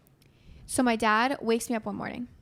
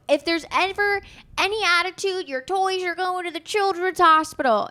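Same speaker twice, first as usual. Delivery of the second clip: higher, louder, stern warning; a father scaring his kids before Christmas; mock stern dad; 8 semitones higher, 5 dB louder than usual. Dad's mock Christmas threat.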